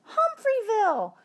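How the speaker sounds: a high, eager cry of the new name; excited shout. Acted. Class calls out the town name, high and eager. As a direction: call the name out high with delight.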